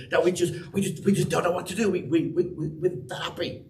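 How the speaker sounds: acted giddy fluster, repeating words; bursting with excitement. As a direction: trip over the words, too happy to finish. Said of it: "Get that excitement and that happiness".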